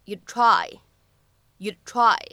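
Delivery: "you'd try" run together, d unreleased; "you'd try" d held before the t. Shown as a demonstration. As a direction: blend "you'd try", d not exploded. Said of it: "应该读成you'd try".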